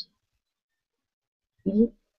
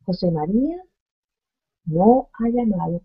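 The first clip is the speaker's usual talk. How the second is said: ordinary delivery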